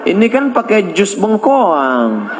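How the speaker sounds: high, slow, coy and laughing; coy giggling reply; giggly woman's voice. Retold or imitated.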